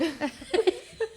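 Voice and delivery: bright high giggle; high laugh. Real, not acted. Laughing at her own joke, high giggle. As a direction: giggle high after the punchline.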